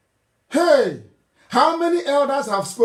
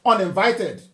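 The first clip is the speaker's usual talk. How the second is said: usual speaking voice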